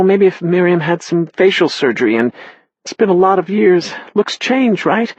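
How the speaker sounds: a hint of a mother near tears; upset Therese. A narrator's reading. Therese doubts the photo is her daughter, high and halting.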